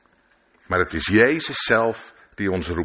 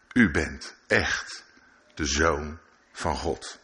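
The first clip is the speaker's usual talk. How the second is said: reverent awe; 5 semitones lower, 5 dB quieter, much slower than usual. Acted. Disciples kneel before Jesus.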